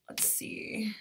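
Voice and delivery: under-the-breath whisper; whispering to self. Real, not acted. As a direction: murmur it to yourself. Host waiting on frozen screen.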